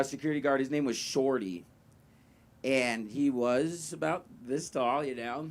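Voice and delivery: ordinary talk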